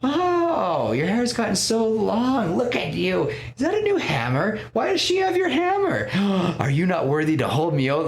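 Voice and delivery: hammed-up playful ribbing with a mock gasp; teasing. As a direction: fuss over an old friend, way up high. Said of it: "Like, just teasing".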